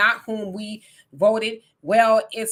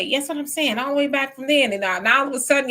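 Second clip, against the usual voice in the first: ordinary talk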